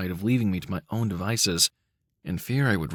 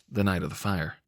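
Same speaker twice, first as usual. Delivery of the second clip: plain reading voice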